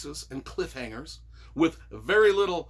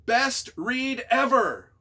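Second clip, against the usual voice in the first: gushing; 6 semitones higher, 4 dB louder, slower than usual. Acted. Reads glowing line from review.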